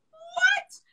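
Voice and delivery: high cry of disbelief; shocked. Real, not acted. Shocked by the guy's remark.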